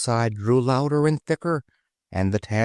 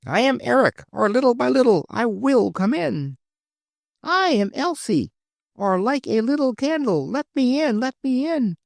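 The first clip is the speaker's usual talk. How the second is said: much higher, pleading to come in; high childlike book voices. Acted. Book children outside the gate pleading, high and thin. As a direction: squeak it like a small storybook child.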